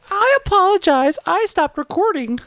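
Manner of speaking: high mocking echo of an apology; mocking imitation.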